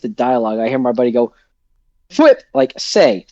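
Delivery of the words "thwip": goblin giggle. Retold or imitated. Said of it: "best I can say is a goblin giggle".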